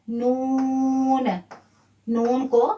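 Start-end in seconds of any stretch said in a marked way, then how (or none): 0.1-1.3 नून drawn out very slowly; held n. Shown as a demonstration. Teacher demonstrating the sukun on the final noon. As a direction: hold नून long before the stop. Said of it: "साकिन कर देंगे".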